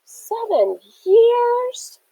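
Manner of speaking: high, stunned question; can't believe it's been that long; shocked disbelief. A narrator's reading. Friend hears the streak length, shocked disbelief.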